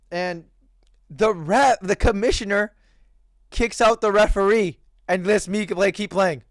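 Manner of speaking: ordinary talk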